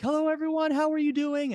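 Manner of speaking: high, bright announcer hello to the crowd; ring announcer greeting. Retold or imitated. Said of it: "I'm the ring announcer for this event".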